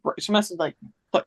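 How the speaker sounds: normal talking voice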